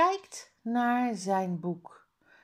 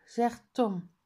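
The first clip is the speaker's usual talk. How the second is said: ordinary delivery, nothing marked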